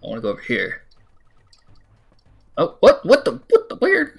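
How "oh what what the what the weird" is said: very high, loud squeal of confusion; startled disbelief. Real, not acted. Gamer baffled by the game mid-jump, startled disbelief. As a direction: yelp it out, baffled and rising high.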